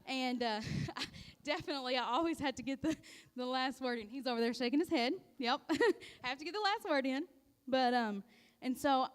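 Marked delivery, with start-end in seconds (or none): 5.4-7.2 laughing through the line, a bit higher and louder; laughing admission. Real, not acted. Telling the church she needs the last word, laughing. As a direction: confess it while giggling. Admits habit to audience, laughing.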